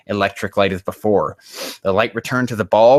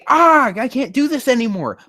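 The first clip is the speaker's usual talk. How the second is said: high, louder hammed-up whining; mock whine; 5 dB louder than usual. Shown as a demonstration. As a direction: show how the character should groan it aloud.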